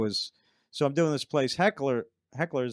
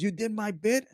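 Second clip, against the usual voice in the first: higher-pitched mock accusation; imitating someone picking an argument; arguing; 8 semitones higher than usual. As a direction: retell an indignant challenge, pitched up. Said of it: "started arguing with me".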